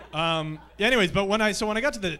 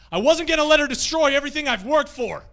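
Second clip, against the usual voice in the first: much higher, much louder growled delivery; overacted tiger line; 9 semitones higher, 9 dB louder than usual. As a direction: unleash the inner tiger on the climactic line.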